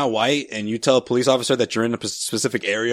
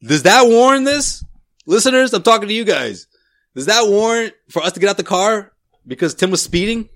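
high, pleading mock outrage to the listeners; mock petrified; 8 semitones higher than usual. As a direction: ham up being terrified and wronged. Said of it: "I'm petrified".

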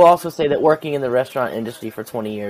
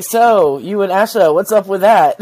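teasing clapback; 7 semitones higher, 8 dB louder than usual. Retold or imitated. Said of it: "The way he said it was so funny".

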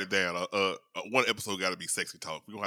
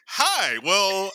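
much higher, louder "Hi, well"; squeaky hi; 10 semitones higher, 8 dB louder than usual. Retold or imitated. Mimicking co-host's awkward flirting, high and loud. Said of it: "and you like".

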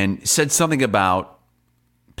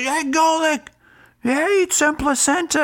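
high mock yell; retold heckler's holler. Host mimicking the fan calling to Golic, high holler.

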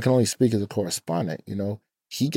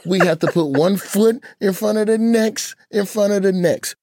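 his dad's voice; 12 semitones higher, 3 dB louder than usual. Retold or imitated. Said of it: "now you're channeling your dad".

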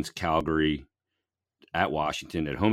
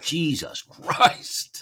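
exasperated wife; 12 semitones higher, much slower than usual. Retold or imitated. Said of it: "She's like".

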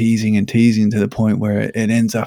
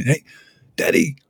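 imitating his daughter, much higher, bossy command; mock little daughter; 8 semitones higher than usual. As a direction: squeal at daddy to stay away like a little girl. Daughter voiced, bossy shouting.

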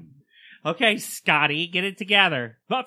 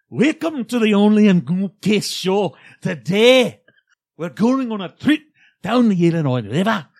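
louder, higher Scottish host voice; Scottish show intro; 4 semitones higher, 5 dB louder than usual. Pretend. Host finally intros the show for his cohost, booming Scottish brogue. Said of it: "in your Brett voice".

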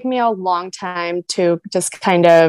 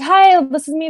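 confident self-promoter; 8 semitones higher, 3 dB louder than usual. Retold or imitated. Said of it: "I would see people around me being like".

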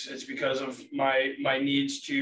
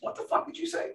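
mock disbelief; 11 semitones higher, 4 dB quieter than usual. Shocked reaction to the mentor.

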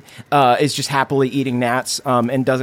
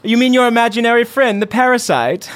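higher, teasing mom voice; indulgent mother; 6 semitones higher than usual. Acted. Mother laughs off her son's talk of a parasite, teasing and indulgent. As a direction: humour the odd boy. Lexana humours son.

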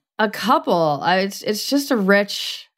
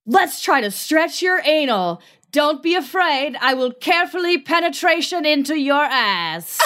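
mock yelling; 6 semitones higher than usual. Host delivering an all-caps title to co-host, mock yelling. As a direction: proclaim it like all caps, pitched up. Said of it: "Then why are you yelling".

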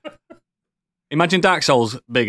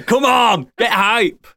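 higher-pitched rallying cry; eager, raised-voice urging; excited hyping up; 5 semitones higher, 3 dB louder than usual. Real, not acted. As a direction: rouse your friends, pitched up. Urging the others to get hyped.

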